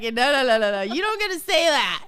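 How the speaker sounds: loud, insistent retold objection; mock protest. Woman refusing to let Tom call her stressed, loud mock protest. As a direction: raise the voice in a playful telling-off.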